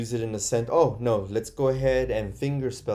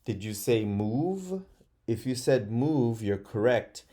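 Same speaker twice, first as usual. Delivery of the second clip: ordinary talk, nothing marked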